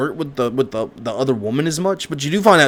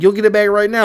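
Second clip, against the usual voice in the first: higher and louder, insisting it be done now; demanding; 8 semitones higher, 4 dB louder, faster than usual. Retold or imitated. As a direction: make the demand sharply. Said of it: "And he's like".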